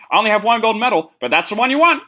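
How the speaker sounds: high, quick boastful voice; Bruce Jenner impression. Podcaster quoting Bruce's gold medal brag in a high voice. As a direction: brag fast with the pitch pushed up. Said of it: "he always says".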